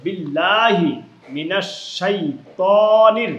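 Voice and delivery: slow, loud recitation with held vowels; measured recitation, long "laa" in বিল্লাহি; one-alif stretch. Shown as a demonstration. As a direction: draw out বিল্লাহি and the "too" in শাইতানির. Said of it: "একালিফ টান হবে".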